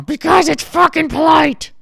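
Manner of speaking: high, loud granny shout; angry granny yelling at her grandson; grandma shouting. Retold or imitated.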